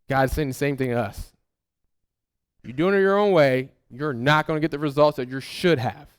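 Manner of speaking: normal talking voice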